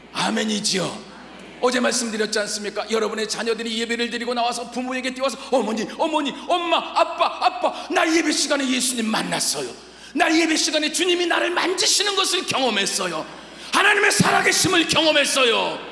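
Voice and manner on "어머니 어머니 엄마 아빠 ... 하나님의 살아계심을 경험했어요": higher, louder breathless joy; excited child. Acted. Child running to mum and dad after worship, excited and loud. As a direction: burst out to your parents.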